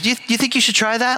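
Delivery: a hint of a gentle wife's question. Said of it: "She goes".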